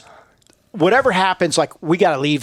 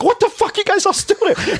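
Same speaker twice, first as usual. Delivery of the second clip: high-pitched, incredulous yell through laughter; shocked disbelief; 14 semitones higher than usual. Retold or imitated. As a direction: retell his stunned shock at us still standing there.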